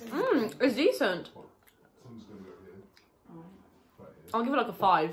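eating while giving a rating; talking while chewing. Real, not acted. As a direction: mouth partly full of pastry. Seen 0: Both hold bitten puffs; faces seem mid-chew; speaker unsure.

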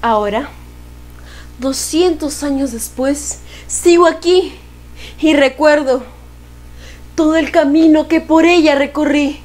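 measured, solemn recital; poem recitation. Acted.

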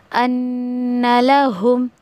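अन्नलहुम recited very slowly with the doubled न held; slow Quranic recitation of अन्नलहुम; tajweed recitation. Shown as a demonstration. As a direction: hold the न in अन्नलहुम, then close slowly. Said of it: "इस तरीके से".

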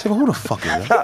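annoyed, incredulous question. Real, not acted. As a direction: ask it like the name offends you. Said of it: "He really sounds so annoyed".

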